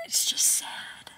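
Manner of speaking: real tearful near-whisper, slow; barely getting the words out after crying. Real, not acted. To viewers about friends moving away, a slow tearful whisper. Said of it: "I'm gonna start crying again".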